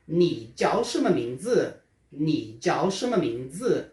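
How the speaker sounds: "Ni jiao shema mingzi?" pausado, marcando os tons; mandarim bem devagar. Shown as a demonstration. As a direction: diga sílaba por sílaba. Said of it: "a pergunta em chinês fica". (pergunta em mandarim, bem lenta)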